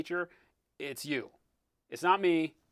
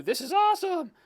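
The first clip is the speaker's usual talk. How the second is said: much higher, gushing delight; 14 semitones higher than usual. Retold or imitated. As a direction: burst out with joy at the model. Quoting a builder's delight.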